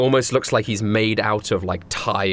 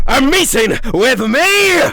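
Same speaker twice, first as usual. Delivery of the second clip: high, loud, slow yell; 7 dB louder, slower than usual. Acted. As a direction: bellow it so the glasses shake. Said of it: "he yells out".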